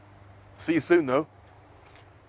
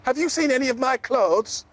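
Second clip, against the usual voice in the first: much higher eager call; 10 semitones higher than usual. Acted. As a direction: call out to the elephant. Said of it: "called Zippity".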